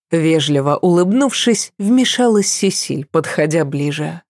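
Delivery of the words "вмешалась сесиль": usual speaking voice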